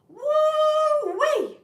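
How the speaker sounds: loud, high woo-wee whoop easing down; Cajun local's holler. Retold or imitated. Cajun man reacting to an outsider's talk, rowdy holler. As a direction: holler it like a Cajun welcoming a stranger. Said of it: "the first thing out of this guy's mouth".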